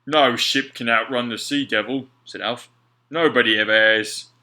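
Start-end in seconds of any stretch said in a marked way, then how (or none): 0.0-2.1 louder, grave sailor voice; Alf, awed sailor. A narrator's reading. Alf to Mac about the Sea Devil, loud grave voice. 3.1-4.3 louder, grave sailor voice; Alf, awed sailor. A narrator's reading. Alf to Mac about the Sea Devil, loud grave voice.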